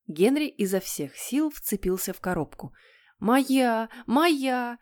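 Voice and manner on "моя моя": high, insistent cry; child's cry. Acted. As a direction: cry out like a child claiming a toy. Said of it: "закричал Питер". (настойчивый детский крик)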